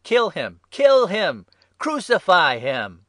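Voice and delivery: very loud, high mob cries; crowd shouting. A narrator's reading. People demanding execution from Pilate, shouting. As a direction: yell for his death with full force. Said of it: "the people shouted".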